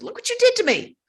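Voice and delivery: high, loud retold outburst; furious. Nun accusing him over her injured hand, acted furious. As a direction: re-enact an indignant, high-pitched accusation. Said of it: "I was furious with him".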